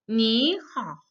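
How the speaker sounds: "你好" rising, then dipping; model reading. Shown as a demonstration. Teacher reads for students to repeat, "你好" deliberately toned. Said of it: "I read a sentence once".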